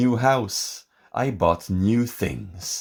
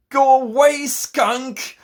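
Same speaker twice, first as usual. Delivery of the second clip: loud, high shout; 5 dB louder, slower than usual. A narrator's reading. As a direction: yell at the skunk to go away. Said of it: "I shouted".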